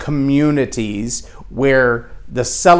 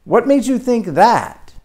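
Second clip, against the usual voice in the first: high, mock-baffled disbelief; pitched-up mock shock at an odd opinion; incredulous what; 9 semitones higher, slower than usual. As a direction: act out a crowd staring at a strange idea. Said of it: "everybody looks at you and goes".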